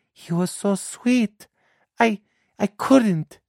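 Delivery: put-on a girl defending the man she let go, meek; lovesick girl. Daughter answers her mother.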